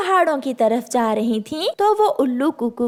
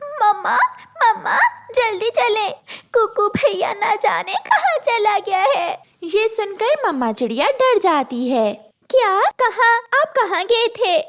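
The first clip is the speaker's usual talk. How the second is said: put-on high, crying child voice; girl telling mother brother is lost; tearful girl; 8 semitones higher than usual. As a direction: sob out the news.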